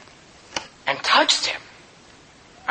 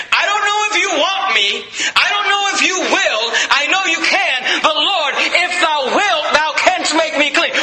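desperate cry; 5 semitones higher than usual. Acted. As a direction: call out like a sinner begging for mercy. Sinner crying out to Jesus.

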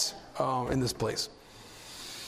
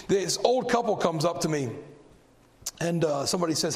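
ordinary talk

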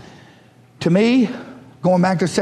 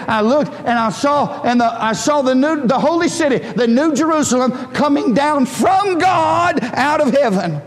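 fervent scripture reading; 7 semitones higher than usual. Acted. Reads the vision with rising fervour.

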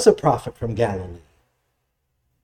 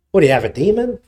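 mock incredulous; 5 semitones higher, 4 dB louder, faster than usual. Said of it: "we should start saying that".

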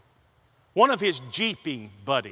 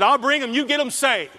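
louder, higher, insistent Andy imitation; Andy's eager promise; 9 semitones higher, 8 dB louder than usual. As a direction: push it the way Andy said it. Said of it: "That's how he said it".

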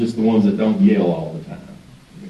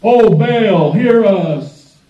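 raised, higher-pitched plea to a god; calling on Baal; 7 semitones higher, 4 dB louder, much slower than usual. Acted. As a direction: call out loudly as if to a distant god. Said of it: "called on the name of Baal".

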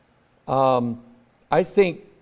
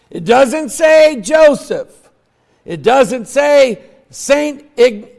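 loud, raised-pitch pulpit preaching; emphatic sermon, ringing out; preaching at full force; 6 semitones higher, 7 dB louder than usual. Real, not acted. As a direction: drive each phrase home to the congregation.

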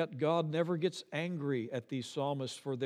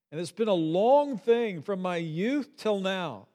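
desperate cry; 5 semitones higher, 6 dB louder than usual. Retold or imitated. Reading desperate psalm.

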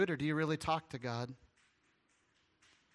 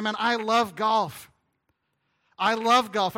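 loud, slow, higher proud claim; loud boast; 5 semitones higher, 10 dB louder, slower than usual. Retold or imitated. Imagined churchgoer boasting loudly about loving golf.

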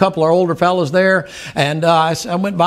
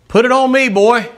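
loud, higher fatherly call; father taking the blame; 8 semitones higher, 6 dB louder than usual. Retold or imitated. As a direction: boom it out as the proud dad.

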